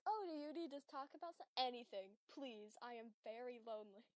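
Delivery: quoting the joke while still laughing; a laughing rerun of the mock begging; imitating own plea. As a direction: replay the lonely plea through giggles. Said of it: "I still, like, just".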